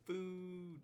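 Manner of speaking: high call across the room; yelling across the room. Acted. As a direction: shout it out to the group. Calling everyone to eat.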